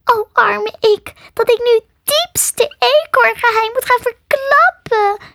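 hammed-up squeaky woe; wailing squirrel. A narrator's reading. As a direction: wail self-pityingly as a small animal. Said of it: "jammerde het".

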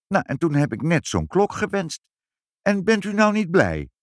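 put-on high, insistent little-girl voice; child justifying herself. Child asking parents if they are pleased, hopeful.

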